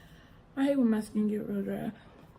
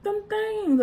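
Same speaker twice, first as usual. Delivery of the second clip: put-on high child voice; 12 semitones higher than usual. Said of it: "I was like".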